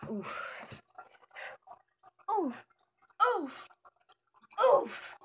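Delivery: practised oof noises. Pretend. Artist trying out oofs for a perfect one, building. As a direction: repeat the oof, each a bit stronger.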